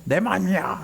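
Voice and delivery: high-pitched mock defiance; a child's huffy comeback, imitated. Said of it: "너 왜 성질 내". (아이가 발끈해 대꾸하듯)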